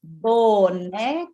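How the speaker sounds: Boneca split into clapped syllables, slow. Shown as a demonstration. Teacher clapping Boneca for the child, slow syllables. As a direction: clap out Boneca syllable by syllable, slowly. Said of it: "Vamos bater palma junto comigo".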